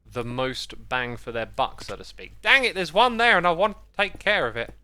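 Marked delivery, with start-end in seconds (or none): 2.4-4.7 agitated. Real, not acted. Spotting mobs while playing.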